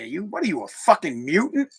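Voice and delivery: ordinary talk, nothing marked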